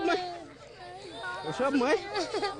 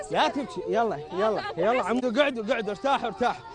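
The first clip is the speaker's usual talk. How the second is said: soothing; 5 semitones lower, 5 dB quieter than usual. Real, not acted. Soldier hushing crying children to sit down and rest, soft and lower. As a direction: settle them down gently, sit, rest.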